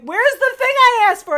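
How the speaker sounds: very high, loud exasperated call; mock yell. Parent yells for the fetched item, high and loud. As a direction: holler up the stairs.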